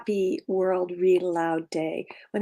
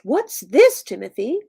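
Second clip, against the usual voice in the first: sputtering father; 4 semitones higher, 8 dB louder than usual. Acted. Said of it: "he muttered and sputtered and asked".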